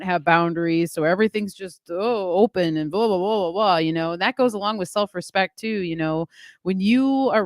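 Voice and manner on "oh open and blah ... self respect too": ordinary talk, nothing marked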